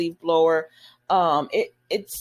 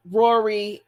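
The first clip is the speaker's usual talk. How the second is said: ordinary talk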